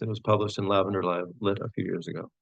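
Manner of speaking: ordinary talk, nothing marked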